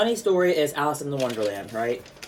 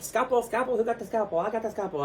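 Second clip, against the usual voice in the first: imitating March Hare; 9 semitones higher, faster than usual. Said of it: "the March Hare's like".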